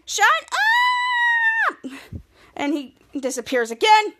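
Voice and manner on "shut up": mock scream with a long second word; retold super scream. Girl silencing Krampus, mock screaming. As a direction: imitate a scream, stretching the last word. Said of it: "she super screams".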